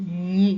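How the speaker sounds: লি said loudly, tongue pressed on the palate; Italian gli demonstrated clearly; loud palatal li. Shown as a demonstration. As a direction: hold the tongue on the roof and say লি. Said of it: "জিহ্বাটা আমাদের উপরের তালুতে লাগিয়ে".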